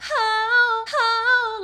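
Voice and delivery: a loud cry break flipping high on how; crying flip. Shown as a demonstration. Coach demos the cry technique on how. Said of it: "flipping into my head voice".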